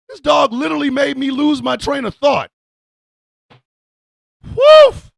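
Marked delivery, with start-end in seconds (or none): 4.5-5.1 high loud playful bark; high yelped woof; mock dog bark. Dog just farted.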